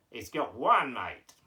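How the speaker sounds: put-on Aussie puppet answer; hammed-up Australian character; Australian accent. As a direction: punch "one" in broad Australian. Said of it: "you're going with the Australian accent".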